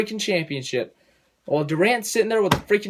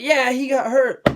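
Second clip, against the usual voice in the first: mock fan cheering; 9 semitones higher, 5 dB louder, slower than usual.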